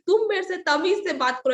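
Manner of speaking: imitating uncle's angry shout. Uncle scolding her over the drain, mock angry shout. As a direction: bark it the way uncle did. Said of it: "ऐसे जोर-जोर से आवाज में बोलने लगे".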